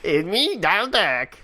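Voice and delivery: squawky cartoon duck voice; Donald Duck voice. Shown as a demonstration. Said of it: "remember what Donald Duck sounds like".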